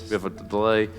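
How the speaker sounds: ordinary delivery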